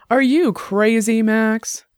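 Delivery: mildly heated, tipsy challenge to a friend; getting loud, tipsy. Acted. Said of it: "her voice getting loud".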